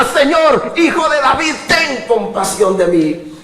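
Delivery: shouted, very high plea to God; crying out. Acted. Preacher urging the congregation to cry out, loud shouted plea. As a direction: cry out at full force to the Son of David. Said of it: "Mejor clama".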